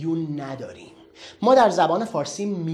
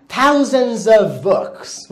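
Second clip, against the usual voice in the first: Thousands of books stretched out very slowly; slow English model; 5 dB louder, much slower than usual. Shown as a demonstration. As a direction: read it out painfully slowly. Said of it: "برو هزاران کتاب". (خیلی آرام و کشیده)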